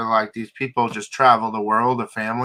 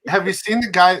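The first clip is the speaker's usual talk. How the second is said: ordinary delivery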